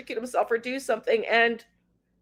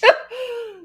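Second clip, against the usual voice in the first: real laugh, higher and louder; 12 semitones higher, 5 dB louder than usual. Real, not acted. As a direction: burst out laughing. Laughs after rib joke.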